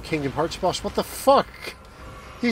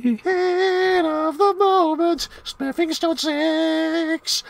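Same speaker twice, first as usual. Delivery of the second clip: slow, high put-on voice; silly high voice; 11 semitones higher, much slower than usual. Player drawls in a goofy high voice.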